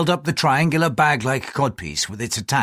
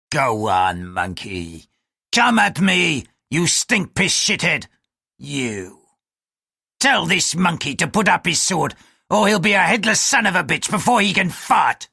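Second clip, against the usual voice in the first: taunting bosun; 5 semitones higher than usual. Acted. Bosun at gunpoint jeering at the samurai. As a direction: spit insults at the samurai.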